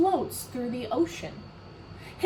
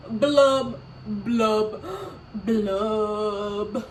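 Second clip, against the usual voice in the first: mock sobbing blub blub blub; hammed-up fish crying noises; dramatic blubbing; 4 dB louder, much slower than usual. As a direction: fake weepy blubs, fading. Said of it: "He is a very dramatic pout pout fish".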